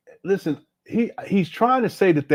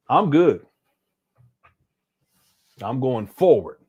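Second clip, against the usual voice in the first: ordinary talk, nothing marked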